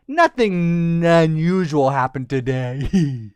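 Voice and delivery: slow, drawn-out cover story; playful fake nonchalance; feigned innocence. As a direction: stretch it out as if hiding a secret. Kids feign innocence, storyteller laughs.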